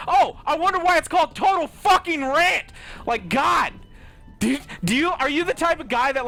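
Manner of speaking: loud, high, mocking yell; exasperated high shout; sarcastic shout. Real, not acted. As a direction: bellow the sarcasm at them. Sarcastic rant at viewers.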